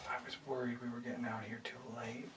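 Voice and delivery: worried soft whisper. Real, not acted. Hunter whispering his worry to the camera.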